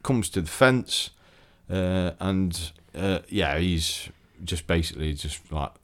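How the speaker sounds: ordinary talk, nothing marked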